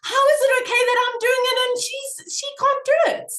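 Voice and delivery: high-pitched, hurried disbelief; indignant self-questioning. Retold or imitated. Mother questioning her own double standard, high and indignant.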